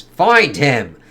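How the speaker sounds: loud commanding shout; shouted order. Retold or imitated. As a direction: bark the order at the computer. Superman demands from AI.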